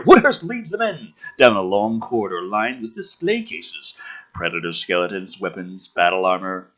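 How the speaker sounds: ordinary talk, nothing marked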